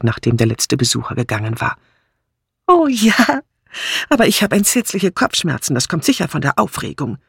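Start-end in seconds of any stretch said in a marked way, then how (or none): 2.7-7.2 high-pitched, fretful voice; excitable Dora, suffering. A narrator's reading. Dora telling her friend of a headache, fretful. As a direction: start very high, then complain of aches.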